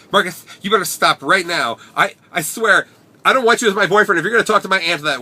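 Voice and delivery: high, loud, flamboyant scolding. Retold or imitated. Boyfriend scolding Marcus in the rain, high, loud, flamboyant. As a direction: camp it up and scold your boyfriend. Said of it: "I apologize for the stereotypical voice".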